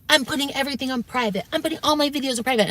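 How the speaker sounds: high-pitched, hurried imitation of his upset; mock mad. Streamer huffs about pulling his videos, mock mad. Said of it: "when he got really mad".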